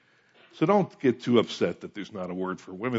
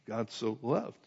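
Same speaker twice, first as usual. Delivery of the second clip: much softer, letting "loved" linger; hushed repeat; 11 dB quieter, slower than usual. Shown as a demonstration. Preacher repeating the verse to congregation, softly stressing "loved". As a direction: drop the volume and dwell on "loved".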